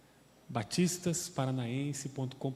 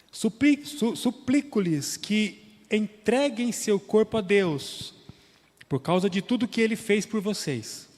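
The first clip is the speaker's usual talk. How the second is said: raised, louder reading of the Bible text; solemn scripture reading; 4 semitones higher, 5 dB louder than usual. Acted. Preacher reading Romans twelve to the congregation, solemn and raised.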